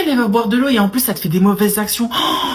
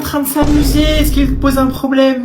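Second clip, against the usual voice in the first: defensive mother; 6 semitones higher than usual. Acted. Mother defends her son.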